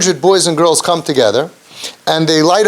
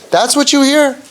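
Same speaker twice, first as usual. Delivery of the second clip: high-pitched disbelieving question; mock-amazed jab, pitched well up; incredulous dig; 10 semitones higher than usual. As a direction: question it as a put-down. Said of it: "The dig".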